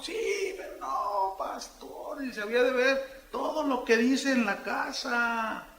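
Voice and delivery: put-on teen's voice. Teenager tells the pastor about his father, pleading. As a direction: play the hurt teen confiding.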